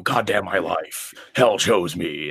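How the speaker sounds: slow, lightly voiced brooding singer; a hint of a kid frontman playing dark; mimicking the young singer's gloomy line; mock death-metal singer. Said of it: "he's like".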